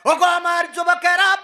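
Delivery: chanting sermon with sudden high-pitched surges; singsong preaching. Acted. Preacher to congregation on animals obeying man, singsong exhortation. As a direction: preach in sustained tones, stretching the phrase endings.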